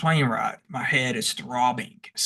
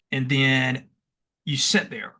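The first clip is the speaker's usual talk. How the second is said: ordinary talk, nothing marked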